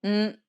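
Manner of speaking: "N" as a held hum, not the letter name; isolated N sound. Shown as a demonstration. Teacher introducing the first consonant, isolated N sound. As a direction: hum a bare "N" as in "nice". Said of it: "The first consonant is".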